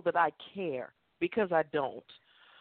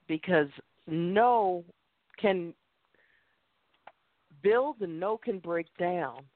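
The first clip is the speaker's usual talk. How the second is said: slow, weighted preaching on no; slow emphasis; slower than usual. Real, not acted. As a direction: lay each word down slowly. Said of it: "preaching point on no".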